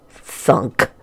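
ordinary delivery, nothing marked

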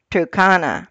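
ordinary delivery

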